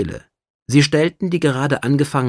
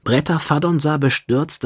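ordinary delivery

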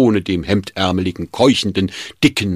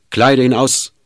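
louder, higher command to a servant; barked order; 6 semitones higher, 6 dB louder than usual. A narrator's reading. Schmidt orders the servant to undress him, barked. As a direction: snap the order at him sharply. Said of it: "herrschte Schmidt den Diener an".

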